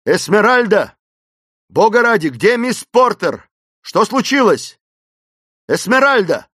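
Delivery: high, loud urgent demand; shouting in her ear. A narrator's reading. Clayton begging fainted Esmeralda for news, shouting. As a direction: shout to rouse someone who has fainted. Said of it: "закричал он ей в ухо".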